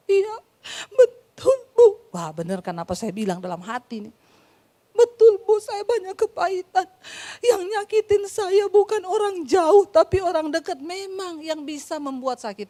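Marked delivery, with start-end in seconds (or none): 0.1-2.1 high, loud, tearful answer through sobs; crying. Retold or imitated. Woman confronted about her bitterness, answers crying. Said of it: "tiba-tiba dia nangis". 5.0-10.8 still crying. Retold or imitated. Woman tells the preacher who hurt her, still crying. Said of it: "tiba-tiba dia nangis".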